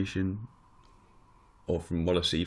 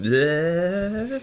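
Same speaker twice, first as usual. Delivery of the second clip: silly bleh; 11 semitones higher than usual. Pretend. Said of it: "the coffee went".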